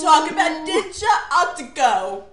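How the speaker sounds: put-on loud, high, overdone English accent; horrible English accent. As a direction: ham up a terrible English accent. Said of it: "not even gonna deny that that was horrible".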